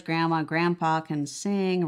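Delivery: normal talking voice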